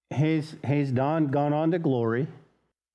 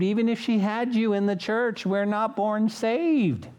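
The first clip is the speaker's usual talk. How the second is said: higher, building preacher's cadence; fervent preaching; 6 semitones higher than usual. Acted. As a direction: press the point home to the congregation. Preaching rises to full force.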